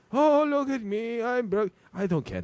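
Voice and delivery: high-pitched mock self-pity; sneering falsetto imitation of a moper; mocking whine. Mockingly acts out the moping rival.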